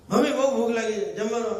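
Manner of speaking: put-on high, hurried child calling out; hungry daughter. Daughter comes home hungry, pestering her mother.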